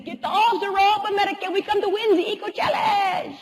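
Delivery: mock a cocky French rival in a heavy French accent. French racer at the rope's foot taunting the American team, in a French accent.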